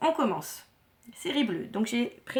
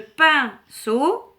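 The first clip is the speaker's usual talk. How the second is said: "pinceau" slowly split into pin and ceau; syllable by syllable; 4 semitones higher, 8 dB louder than usual. Shown as a demonstration. Teacher introduces the last puzzle word, syllable by syllable. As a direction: pause between the two syllables of "pinceau". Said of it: "composé de deux syllabes".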